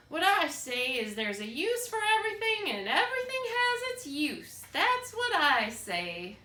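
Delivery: put-on loud, high old woman voice, slowing at the end. A narrator's reading. Old woman telling Edward her rule, loud and high.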